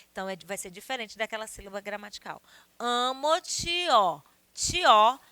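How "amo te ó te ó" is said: "te" glided into "ó" as one syllable, ti-ó; vowels joined. Shown as a demonstration. Teacher showing one poetic syllable, "Te, ó" fused as ti-ó. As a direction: say "Amo-te, ó" stretched, then isolate a loud "ti-ó". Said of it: "é uma sílaba poética".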